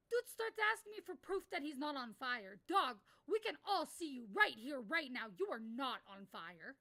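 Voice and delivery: incredulous, raising the voice; exasperated rant. Real, not acted. Streamer rants to chat, loud exasperation.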